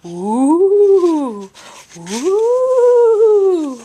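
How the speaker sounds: loud, high owl cry; loud hooting owl call; owl shout. Acted. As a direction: hoot out like an owl trying to scare. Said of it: "flapped his wings and shouted".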